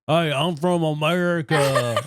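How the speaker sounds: put-on British people faking an American accent, laughing; mock American accent. Mocking how the English do an American accent. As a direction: ham up a silly American accent through a laugh. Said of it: "Can you do your best American accent?".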